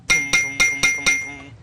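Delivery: loud thumping knocks; knocking sounds. Shown as a demonstration. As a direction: knock knock on wood. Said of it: "you knock".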